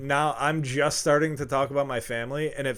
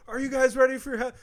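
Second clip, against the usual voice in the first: hype-man shout; 10 semitones higher, 6 dB louder, faster than usual. Retold or imitated. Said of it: "And then I go".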